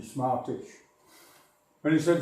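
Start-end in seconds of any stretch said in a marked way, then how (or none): none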